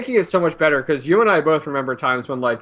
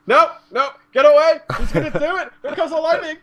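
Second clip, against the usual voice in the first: higher, giggling nope nope; laughing mock panic; 11 semitones higher, 3 dB louder than usual. Player dreading Zeus lightning, laughing mock panic. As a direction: ham up the dread of incoming lightning.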